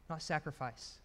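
usual speaking voice

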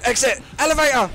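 high, loud, thrilled spotting; excited shouts. Real, not acted. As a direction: shout it like you found the escape.

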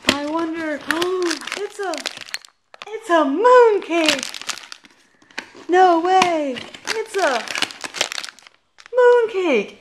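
high, loud hammed-up amazement; mock surprise. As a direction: fake astonishment at the obvious. Mock surprise at mooncake again.

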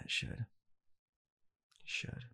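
ordinary talk

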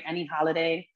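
normal talking voice

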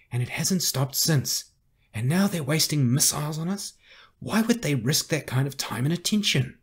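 indignant, insistent challenge to command; urgent argument. Acted. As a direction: build the case with rising urgency. Carrie argues against retreat.

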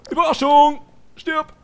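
sudden loud high shout; mock ambush shout. Hiding gamer pretends to ambush, loud shout. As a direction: jump out yelling surprise. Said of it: "hier verstecken und sagen".

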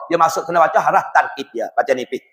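ordinary delivery

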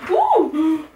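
sharp high yelp of surprise; nearly dropping the album; startled ooh. Real, not acted. Fumbling the album and startled by a near drop.

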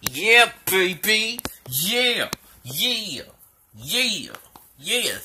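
whooping yeahs; excited cheering. Real, not acted. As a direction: cheer in triumph. Celebrating with dog.

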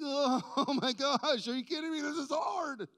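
high, loud mock wail; people's lament. The people hearing the law, mock outcry. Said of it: "They're like".

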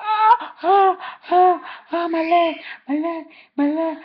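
high, loud mock cries of pain; retold pain cries. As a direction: imitate the godmother crying out in pain. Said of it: "she woke up screaming".

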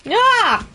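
high sudden yelp of fright; startled yelp. Real, not acted. Streamer yelps high as the creature lunges. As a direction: jump and yelp. Spider creature jumps at her.

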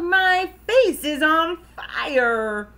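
high, loud, drawn-out howl; yelling. A narrator's reading. Froggy burned by Dad's aftershave, yelling. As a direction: howl it, stretching the last word. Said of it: "he yelled".

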